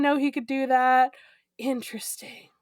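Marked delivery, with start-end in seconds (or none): 1.6-2.5 breathy whispered aside. Real, not acted. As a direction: whisper it to yourself. Unvoiced aside.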